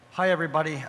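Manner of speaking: usual speaking voice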